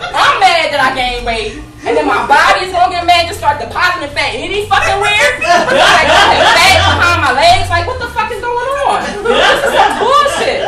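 loud, high furious rant; hammed-up outrage, loud and high. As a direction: vent at the doctor, full blast. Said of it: "I said".

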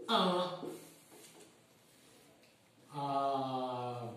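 long pauses between words so students can write; dictation pace. Shown as a demonstration. Teacher dictating a question for the class to note, slow and paused. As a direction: dictate slowly, word by word. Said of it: "vous notez".

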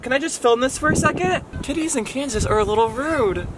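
high, sing-song voice for the kitty; pet talk. Real, not acted. Vlogger teasing the cat she's filming, high pet voice. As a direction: coo at the cat while filming.

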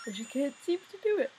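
talking through a yawn. Real, not acted. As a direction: yawn while you speak. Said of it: "I keep yawning".

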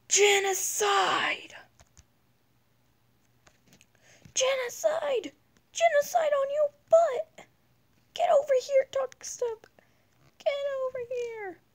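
very high shrieking battle cry; berserk rage. Pretend. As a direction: scream it like a maniac. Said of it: "then you'll know what this means".

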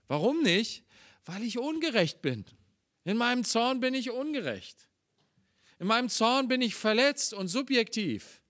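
a preacher pleading with conviction; impassioned preaching. Acted. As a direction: press the point with rising fervour. Preaching with rising intensity.

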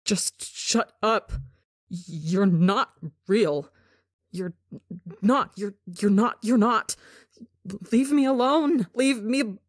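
frantic. A narrator's reading. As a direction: break down, begging to be left. Frantic protests.

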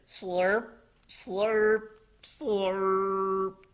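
high slurps, last one drawn out; imitated slurping. A narrator's reading. Froggy and Polly chow down, high exaggerated slurps. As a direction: play the word like noodles being sucked up.

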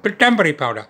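"Pitambari powder" in a light put-on Indian accent. Asking the Indian grocer for "Pitambari powder" in a light Indian accent. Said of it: "Put on a bit of an accent".

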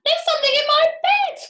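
very high, loud panicked shriek; screamed. A narrator's reading. Missus Twit bouncing about in bed, screaming. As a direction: squeal it out in terror. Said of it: "screamed Missus Twit, bouncing about".

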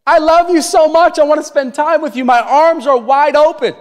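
put-on Father in heaven calling his children, eager and loving; God the Father. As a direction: open your arms with your voice. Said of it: "Our Father in heaven's like".

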